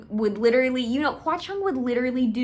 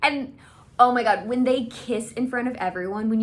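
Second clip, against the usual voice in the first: usual speaking voice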